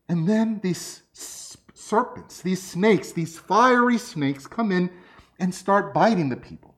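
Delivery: ordinary talk, nothing marked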